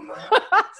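bright high burst of laughter; real giggle after swearing. Real, not acted. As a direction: laugh at yourself. Laughs at own outburst.

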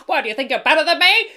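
put-on loud, high Brooklyn accent, pushing back; brash 1930s Brooklyn American accent; defensive American accent. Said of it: "and you suddenly turn American".

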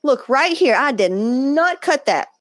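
high, insistent mock protest, slowing down; defending herself in a child-like protest; defensive denial. Retelling her kid's denial, high and insistent.

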